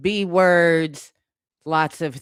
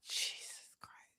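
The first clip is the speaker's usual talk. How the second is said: breathless whispered Jesus Christ; barely voiced, stunned aside; shocked whisper; 10 dB quieter than usual. Real, not acted. Shocked at the lyrics.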